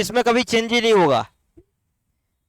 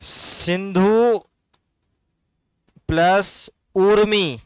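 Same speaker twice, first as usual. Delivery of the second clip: slow split demo; much slower than usual. Shown as a demonstration. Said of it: "सिंधूर्मी".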